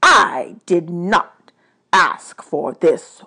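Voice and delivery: slow, emphatic god of the dead; weary, angry god. Acted. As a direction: weigh every word with displeasure.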